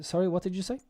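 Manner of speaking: high-pitched "Sorry", rising on "say"; courteous high lilt with "say" going up; nice polite intonation. Shown as a demonstration. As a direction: ask it politely, high, lifting "say" at the end. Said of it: "say it in a nice intonation".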